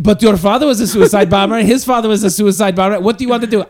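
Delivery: high, loud scolding mother. Retold or imitated. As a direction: nag him like a mother. Mother scolding in comic bit.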